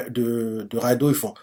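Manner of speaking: plain reading voice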